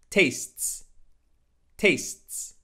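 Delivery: TASTES in one syllable, "taysts"; correct tastes. Shown as a demonstration. Teacher modelling tastes, just an s added at end. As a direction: finish "tastes" on a crisp STS cluster. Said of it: "add a simple sound of s".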